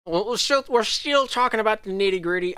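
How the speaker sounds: slipping into a light Southern accent; drawling the league update in a Southern accent. Real, not acted. Host chatting to viewers, in a mild Southern accent. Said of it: "I don't know why I'm going into a Southern accent today".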